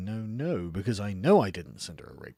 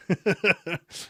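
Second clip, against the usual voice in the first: loud high burst of laughter; 8 semitones higher, 6 dB louder than usual. Real, not acted. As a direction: crack up at the joke. Amused at "mad lad" greeting.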